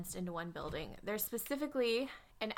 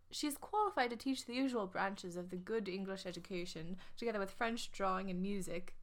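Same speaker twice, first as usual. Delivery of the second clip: put-on ad copy in a light English accent. Reading the ad's qualifications to listeners in an English accent. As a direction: read it like a proper English governess's notice. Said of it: "her ad was, um, in an English accent".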